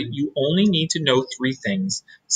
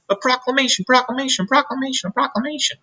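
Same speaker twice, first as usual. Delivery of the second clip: echoing chatter; 12 semitones higher, faster than usual. Acted. Echoing the news around.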